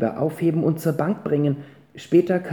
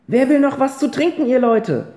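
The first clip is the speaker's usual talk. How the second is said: high, louder call across the room; 5 semitones higher, 4 dB louder than usual. Acted. Ponyhütchen calls to everyone with the jug, high and loud. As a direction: raise the voice to the whole table. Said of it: "hielt die große Kanne hoch und rief".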